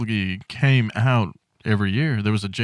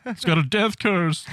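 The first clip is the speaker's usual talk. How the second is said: creepy doomsayer; 9 semitones higher than usual. Retold or imitated. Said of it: "imitating Crazy Ralph's warning".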